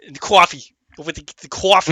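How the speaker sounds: loud mock Jersey "coffee"; teasing New Jersey accent. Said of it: "let me do your, your, your accent".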